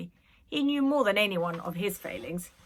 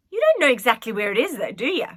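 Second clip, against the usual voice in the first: fast, louder taunting question; smug retort; 4 semitones higher, 10 dB louder, faster than usual. A narrator's reading. Street girl retorts smugly to Nanny, fast and loud. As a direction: needle her with it. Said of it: "she retorted".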